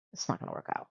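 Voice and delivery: whispered aside, "not" leaned on; breathy conspiratorial whisper to the audience. Real, not acted. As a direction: let the viewers in on a secret. Aside to viewers about the couple.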